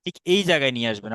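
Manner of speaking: normal talking voice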